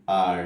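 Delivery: আর said loudly as a plain open vowel with no r; non-rhotic r. Shown as a demonstration. Teacher loudly models the British r without rolling. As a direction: say আর as a long open ah. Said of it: "অর্থাৎ শুধু আর".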